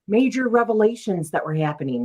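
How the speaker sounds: usual speaking voice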